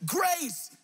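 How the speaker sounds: high-pitched cry without much volume; acted shout. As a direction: call it out high to a rock. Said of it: "I said shout".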